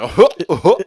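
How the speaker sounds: loud, goofy cartoon-dog chuckle. Trying Goofy on request for co-host, loud cartoon chuckle. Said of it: "Yeah, he's like".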